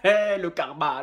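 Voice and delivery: ordinary delivery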